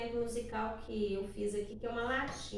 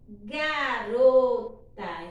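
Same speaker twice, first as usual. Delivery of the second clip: Garota stretched very slowly, syllable by syllable; very slow sounding out; 10 dB louder, much slower than usual. Shown as a demonstration. Teacher sounding out the word for a child, very slowly. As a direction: drag out every syllable of Garota. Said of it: "forma pra mim a palavra garota".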